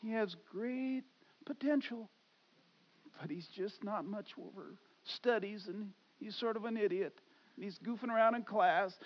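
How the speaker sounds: a hint of a teacher's soft report. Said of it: "every class teacher I ever stood next to said that".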